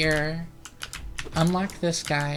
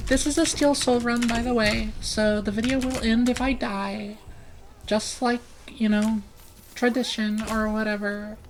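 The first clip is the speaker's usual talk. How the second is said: higher-pitched practised voice; put-on higher voice; 7 semitones higher than usual. Shown as a demonstration. Streamer with voice dysphoria narrating the game, pitched up. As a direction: hold your voice up in a higher register. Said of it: "try to make my voice sound like this".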